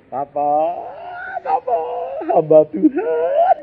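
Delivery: put-on child's words laughed out, high and slow; laughing quote. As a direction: retell the kid's line while cracking up. Preacher retells his child's quip. (kutipan anak sambil tertawa)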